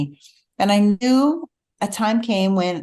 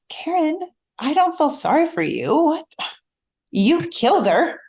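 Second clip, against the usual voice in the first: higher-pitched mock scolding; exasperated; 8 semitones higher than usual. Husband refusing to pity his wife, exasperated. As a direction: imitate an incredulous, pragmatic husband.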